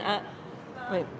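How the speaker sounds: ordinary delivery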